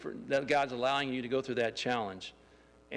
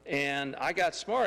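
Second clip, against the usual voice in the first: usual speaking voice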